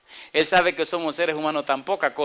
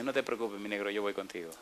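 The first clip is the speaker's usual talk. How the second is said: much softer, lower tender comfort; soft reassuring God; 5 semitones lower, 11 dB quieter than usual. Acted. God reassuring a frightened believer, soft and low. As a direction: comfort him gently, almost confiding.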